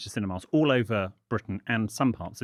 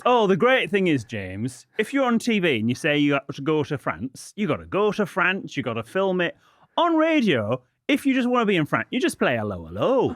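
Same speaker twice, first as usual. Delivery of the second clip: loud, high excited tale of Jordan's gaffe; retelling Jordan; 7 semitones higher, 5 dB louder than usual. Retold or imitated. Presenter retells his co-host's gaffe, high and loud. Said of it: "Jordan goes".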